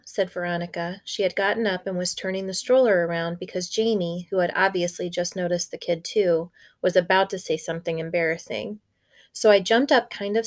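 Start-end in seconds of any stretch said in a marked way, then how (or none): none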